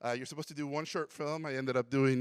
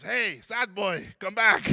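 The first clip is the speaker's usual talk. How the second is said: higher and much louder call, slowed at first; 6 semitones higher, 6 dB louder, slower than usual. Retold or imitated. Teacher calling the student back to the room, loud. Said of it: "he says".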